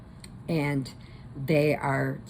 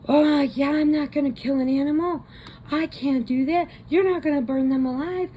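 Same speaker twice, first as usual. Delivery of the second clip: indignant refusal; 10 semitones higher, 4 dB louder than usual. Retold or imitated. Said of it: "And the guy goes".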